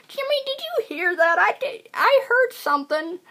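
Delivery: put-on high, wavering old-man voice; mock a deaf old man. Mocking old men on a boat asking Jimmy about a noise.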